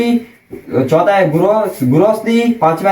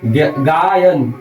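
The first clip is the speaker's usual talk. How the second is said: slow demo; 2 semitones lower, much slower than usual. Shown as a demonstration. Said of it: "गे plus अन".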